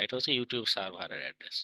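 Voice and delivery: normal talking voice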